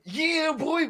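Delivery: mock hype call. Host Sean hyping him on the show, high mock cheer. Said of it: "And Sean was like".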